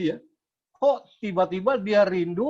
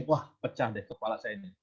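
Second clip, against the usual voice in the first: inner fearful thought; 7 semitones lower, 7 dB quieter than usual. Retold or imitated. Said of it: "saya berpikir begini".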